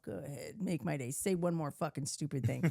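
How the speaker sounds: a hint of squinting gunslinger threat; Clint Eastwood. Daring her boyfriend to speak, a lightly voiced Eastwood menace. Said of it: "I'm like Clint Eastwood".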